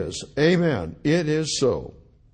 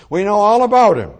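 big, loud scoffing cry; loud scornful crowd; 6 semitones higher, 11 dB louder than usual. Retold or imitated. Preacher shouting the crowd's scorn at the congregation. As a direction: throw it out as a mocking shout.

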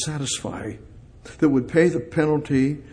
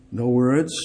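ordinary talk

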